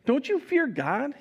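high, slow, indignant question; rebuking. Acted. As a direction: rebuke him with drawn-out disbelief. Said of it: "the other criminal rebuked him".

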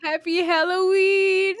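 loud, high, slow jump scare shout; startling boo. Acted. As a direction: yell it to make them jump. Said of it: "Did I scare you sufficiently?".

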